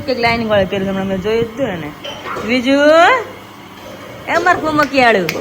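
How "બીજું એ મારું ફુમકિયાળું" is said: high, loud, playful cooing. Real, not acted. Calling the little one by pet names, playful high cooing.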